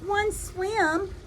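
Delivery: lively storybook reading voice; reading to children. A narrator's reading. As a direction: read it like a picture book to little kids. Reads a picture book aloud.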